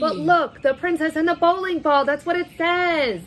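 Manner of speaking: insistent, excited. Real, not acted. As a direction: point at the page and insist. Insists the title says bowling ball.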